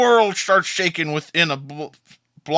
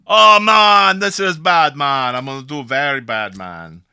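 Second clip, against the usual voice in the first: Jamaican man; 5 dB louder than usual. Acted. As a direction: lament in a broad Jamaican accent. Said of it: "this large Jamaican man".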